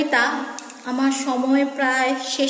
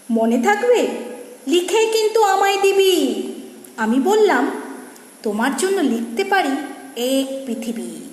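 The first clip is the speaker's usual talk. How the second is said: put-on animated verse, higher for the girl's lines; poem recitation; 5 semitones higher than usual. Reciter voicing boy and cloud girl, animated recitation. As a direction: act out the dialogue in lilting, swooping verse.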